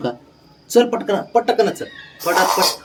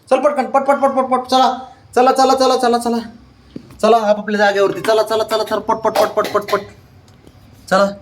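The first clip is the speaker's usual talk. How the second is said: hurrying call; 8 semitones higher than usual. Real, not acted. Hurrying dogs to their places.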